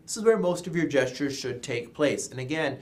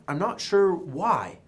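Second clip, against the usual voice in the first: usual speaking voice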